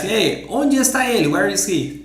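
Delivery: ordinary talk, nothing marked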